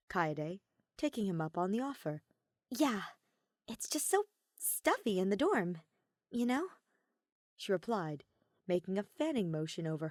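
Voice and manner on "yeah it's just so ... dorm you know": Kaede's voice. Acted.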